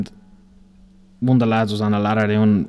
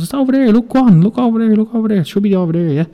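much higher, louder, sing-song insistence on over there; mock innocent wind-up; 12 semitones higher, 5 dB louder, faster than usual. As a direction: coax him like a kid into a fool's errand. Colleague winding up the apprentice.

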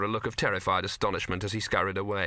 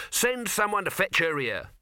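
high-pitched, impatient order; commanding boss; 8 semitones higher than usual. Acted. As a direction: call the order out to an underling. Boss shouts order to nephew.

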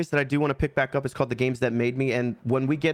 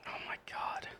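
breathless whisper of dread; 17 dB quieter than usual. Real, not acted. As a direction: whisper it in horror. Dreads the scariest hot sauce.

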